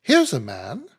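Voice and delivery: a touch of sudden delight; sudden exclamation. A narrator's reading. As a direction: blurt out "Here's a man!" unexpectedly. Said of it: "wholly unexpected exclamation".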